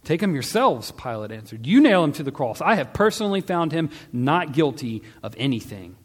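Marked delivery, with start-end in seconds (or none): 0.0-0.9 raised, loud retort; exasperated retort. A narrator's reading. Pilate answering the yelling priests, loud and exasperated. 1.7-6.0 high, loud, emphatic pushback; exasperated retort. A narrator's reading. Pilate refusing to nail Jesus, loud and exasperated.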